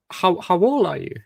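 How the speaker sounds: usual speaking voice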